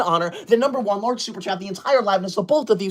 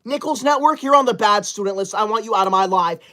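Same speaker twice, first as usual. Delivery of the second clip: normal talking voice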